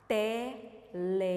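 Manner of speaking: "Telefone" very slowly, pausing after every syllable. Shown as a demonstration. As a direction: dictate "Telefone" in four separate beats. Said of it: "nós vamos falar pausadamente".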